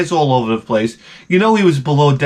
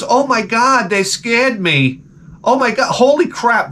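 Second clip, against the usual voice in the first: high-pitched, drawn-out fake scare; mock fright; 8 semitones higher, slower than usual. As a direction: imitate a show host acting startled. Imitating show host's mock fright.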